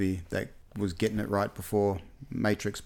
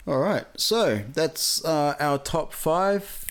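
slow, higher host wrap-up; drawn-out announcer close; show host sign-off; 6 semitones higher, much slower than usual. Acted. Wrapping up the show.

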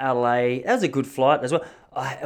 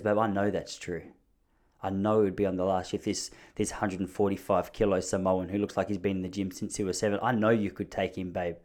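low, soft placating voice; soothing wife; 4 semitones lower, 6 dB quieter than usual. Acted. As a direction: humour him gently, acting the patient wife. Wife soothing his ego, voiced.

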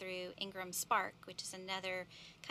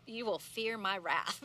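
mock stern teacher; 7 dB louder, slower than usual. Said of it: "And I do say that".

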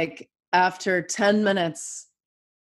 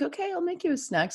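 sleepy mom; 12 semitones higher, 5 dB quieter, faster than usual. Retold or imitated. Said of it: "I was like".

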